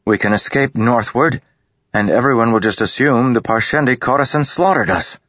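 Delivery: eager. A narrator's reading. Kaladin eagerly explaining the escape plan to the bridgemen. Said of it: "Kaladin said eagerly".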